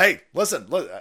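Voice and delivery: ordinary delivery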